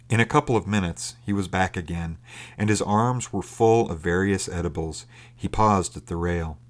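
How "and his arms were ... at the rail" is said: usual speaking voice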